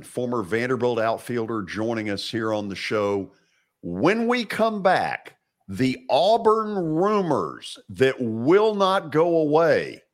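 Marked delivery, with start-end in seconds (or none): 3.8-10.0 high, slow hype before the break; announcer tease. Acted. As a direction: sell the teaser like a showman.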